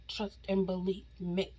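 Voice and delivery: usual speaking voice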